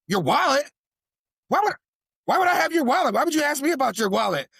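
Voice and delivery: high, fast, offended protest; indignant outburst. Retold or imitated. Friend accused of taking the wallet, high and indignant. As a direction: fire back like you're insulted.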